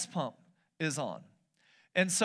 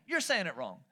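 usual speaking voice

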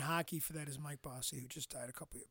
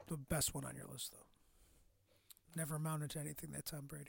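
sarcastic; 2 semitones lower, 11 dB quieter than usual. Acted. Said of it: "That's called sarcasm".

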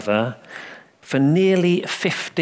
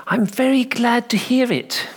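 much higher, sweetly kindly vicar; solicitous kindly tone; 11 semitones higher than usual. A narrator's reading. Vicar answering the little boy, solicitous and kindly. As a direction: coo it like a kindly clergyman. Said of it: "in that solicitous and kindly tone".

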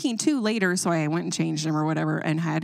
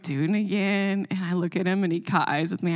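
ordinary talk, nothing marked

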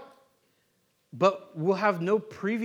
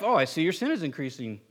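mock judgmental; 6 semitones lower, 3 dB quieter than usual. Churchgoer eyeing someone's increasing sin, mock judgmental. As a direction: pretend you're not judging, quietly.

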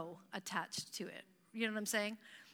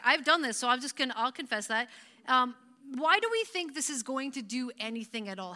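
normal talking voice

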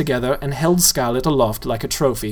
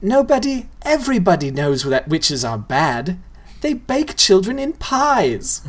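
put-on girl's voice; 6 semitones higher than usual. Girl voiced in story.

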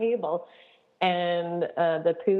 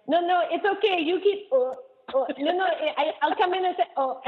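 lightly imitated French accent, higher and fluttery; a hint of the French doctor's accent, pitched up; 10 semitones higher than usual. Doctor reassuring a camp leader, in a light mock French accent. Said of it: "she had a French accent".

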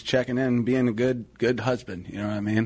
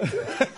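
high burst of laughter; 11 semitones higher than usual. Real, not acted. Cracking up at the joke, high burst of laughter. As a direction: crack up helplessly. Buckled onto the floor.

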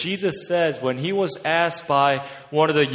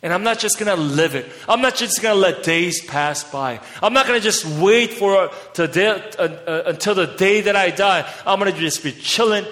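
preaching louder and higher, building passion; fiery preaching; 4 semitones higher, 3 dB louder than usual. Acted. Preacher urging his church to give everything, at full force. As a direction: whip the congregation up into the race.

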